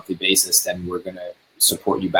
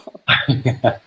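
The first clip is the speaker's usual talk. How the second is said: kind gentle laugh; 3 semitones higher than usual. Real, not acted. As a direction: laugh gently, not mocking. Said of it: "I'm not laughing at you".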